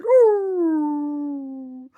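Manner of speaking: one yap like a little dog; small dog bark. Retold or imitated. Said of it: "he said".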